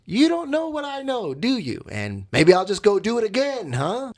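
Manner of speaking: high, taunting sing-song; sarcastic. Acted. Cheater taunting unknowing girlfriend, sarcastic. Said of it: "I'm being sarcastic the way I'm saying it".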